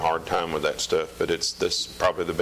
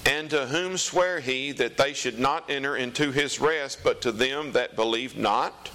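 raised, slow solemn Bible reading; scripture reading; 5 semitones higher than usual. Acted. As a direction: read it out like the pulpit. Reads scripture aloud.

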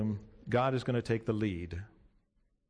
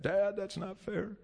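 put-on whining child voice, pitched up; whiny kid; 5 semitones higher, 3 dB quieter than usual. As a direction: complain like a sulky kid. Kid whining about chores.

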